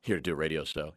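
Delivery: low, soft, casually cool; quiet, laid-back cool delivery; trying to sound cool. Shown as a demonstration. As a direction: play it like the coolest dude in the room. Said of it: "I said it just like that".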